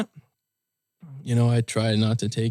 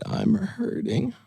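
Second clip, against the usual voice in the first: slow, higher, softened hurting voice; retold tearful confession; 9 semitones higher, 3 dB quieter, much slower than usual. Telling his sister he's hurting, tearful. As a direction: recount it slowly, on the edge of tears. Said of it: "start crying".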